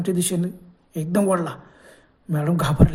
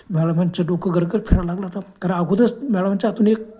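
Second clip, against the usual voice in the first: ordinary delivery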